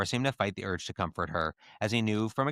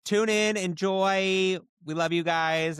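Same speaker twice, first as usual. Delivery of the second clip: bubbly sign-off; 9 semitones higher, 4 dB louder, slower than usual. Real, not acted.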